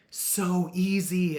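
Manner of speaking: high, loud and drawn out "so easy"; amazed emphasis. Real, not acted. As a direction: stretch it out, marvelling. Amazed at quitting sprays.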